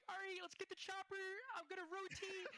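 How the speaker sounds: high imitation of a teammate; squeaky-high teammate shot call; Ricky's voice. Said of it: "He's like".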